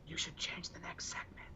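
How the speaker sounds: unvoiced whisper to the side; a quick whispered note to the co-host; whispered aside. Real, not acted. An aside to the co-host, whispered.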